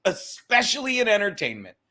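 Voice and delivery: ordinary delivery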